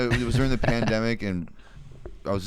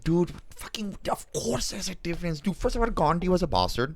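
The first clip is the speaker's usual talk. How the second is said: mock Indian accent; 6 semitones higher, 5 dB quieter than usual. Mocking Indian guys angry.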